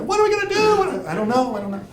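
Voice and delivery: a sudden high-pitched fake meltdown; mock panic. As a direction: squeal it like a panicking tourist. Panicked retold self quote.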